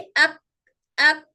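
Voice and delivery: अक, अक ending on a front kaf, tongue stopping against the hard palate; kaf drill syllables. Shown as a demonstration. Teacher showing children where kaf stops, crisp demo. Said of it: "काफ के लिए भी अक बोल के देखिए".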